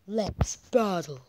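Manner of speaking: slow, loud, stretched battle call; slow motion. Acted. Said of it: "I didn't do that voice right".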